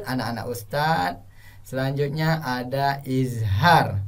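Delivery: usual speaking voice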